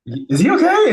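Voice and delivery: worried teammate quote. Retold or imitated. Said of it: "Panay's like".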